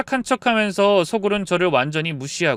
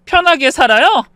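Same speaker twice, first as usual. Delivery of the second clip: higher, sharper indignant question; escalating disbelief; 6 semitones higher, 4 dB louder than usual. A narrator's reading. Daughter-in-law challenging her mother-in-law once more, outraged. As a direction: push 편하게 high and forcefully, outraged.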